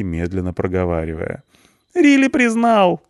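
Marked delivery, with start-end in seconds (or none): 1.9-3.0 very high, loud shout. A narrator's reading. Punk shouts from the cell to the policeman, loud high yell. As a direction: yell it out in protest. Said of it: "кричит Панк".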